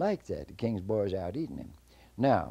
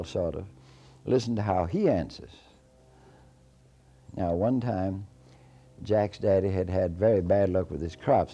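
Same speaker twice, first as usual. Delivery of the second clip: ordinary delivery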